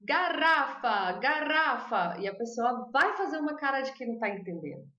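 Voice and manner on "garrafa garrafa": "Garrafa" said slowly and deliberately, twice; slow careful repeat. Shown as a demonstration. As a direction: stretch out "garrafa" hoping to be understood. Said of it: "Você vai falar".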